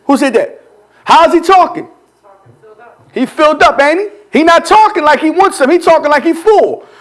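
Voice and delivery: ordinary delivery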